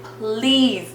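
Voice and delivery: one long, drawn-out plea; begging. Retold or imitated. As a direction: stretch it out as a re-enacted beg. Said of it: "I'm begging her".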